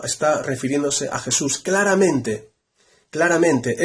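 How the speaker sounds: ordinary delivery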